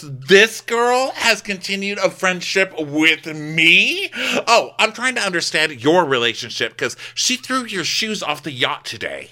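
hammed-up outrage about the yacht; mock incredulous Heather. Co-host retelling Heather's scoff, laughing in disbelief. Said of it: "She goes".

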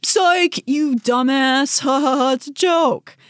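high, slow gloating taunt with a mock laugh; a parent jeering psych at a crying kid, high and gloating; mocking prank parent. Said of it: "then they're like".

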